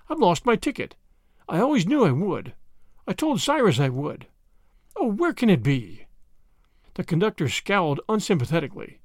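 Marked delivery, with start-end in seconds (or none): none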